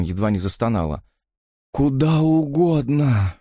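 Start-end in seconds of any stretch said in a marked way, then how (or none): 1.7-3.3 high, very slow yearning sigh; breathed-out plea. A narrator's reading. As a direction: breathe it out longingly. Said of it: "выдохнула она". (умоляюще, на выдохе)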